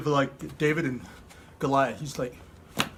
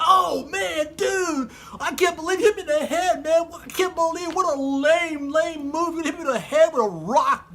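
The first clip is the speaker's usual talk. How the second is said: high, whiny outraged dude; pitched-up mock-furious tirade; indignant complaint; 9 semitones higher than usual. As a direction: rant in comic disbelief at a cheap hit. Struck Goliath complains and threatens.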